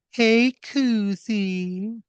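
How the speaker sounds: high, very drawn-out singsong call; slow, high mock-girlish greeting; girls' chorus greeting. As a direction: coo it like a group of admiring waitresses. Girls greet Koozie in unison.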